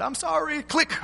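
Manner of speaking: high, clipped sing-song sorry before hanging up; a breezy mock-polite sorry; curt sing-song goodbye. Pastor to ranting caller, breezy sing-song sorry. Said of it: "And so I said".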